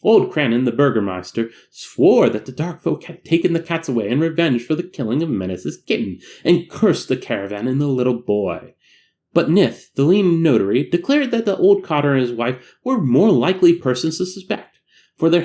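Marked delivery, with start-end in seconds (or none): none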